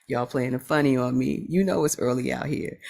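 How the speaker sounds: ordinary delivery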